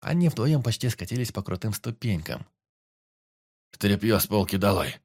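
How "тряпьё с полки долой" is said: commanding. A narrator's reading. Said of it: "продолжил командовать лесник".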